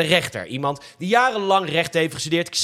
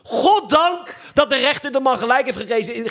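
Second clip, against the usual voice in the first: usual speaking voice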